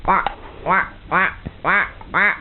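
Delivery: duck quack. Pretend. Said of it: "Oh, I'm a duck".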